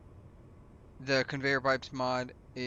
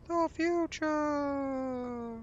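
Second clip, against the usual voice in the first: "The future." drawn out very slowly and pitched far higher; spooky echo; 14 semitones higher, 3 dB louder, much slower than usual. Shown as a demonstration. A YouTuber tells viewers he's speaking from the future, in a slow, high, eerie voice. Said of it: "talking to you from the future".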